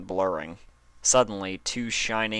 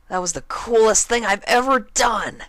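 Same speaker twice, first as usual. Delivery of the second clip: thrilled; 9 dB louder than usual. Acted. Dash gushes to Applejack after the knife, loud and thrilled. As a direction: drag it out with thrill.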